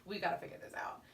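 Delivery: whispered, calm and steady; a wife whispering to her upset husband, calm and steady; hushed reassurance. Retold or imitated. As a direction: talk him down under your breath. Mother calms upset husband.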